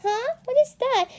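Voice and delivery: put-on high, questioning child character voice; Elizabeth. Shown as a demonstration. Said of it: "I give you my Elizabeth accent impression".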